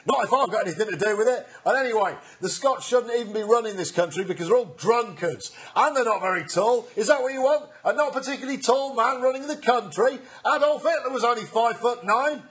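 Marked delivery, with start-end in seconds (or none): 4.5-12.4 ranting outrage. Acted. Ranting mock political speech.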